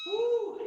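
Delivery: high whooping cheer; excited woo. Real, not acted. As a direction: whoop to pump up the class. Cheer between workout rounds.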